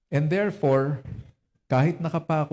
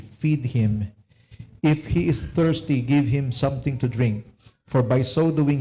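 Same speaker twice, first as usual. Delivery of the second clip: scripture reading. Acted. Pastor reading Romans to the congregation, solemn and deliberate. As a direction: read each verse slowly and deliberately. Reads Bible passage aloud.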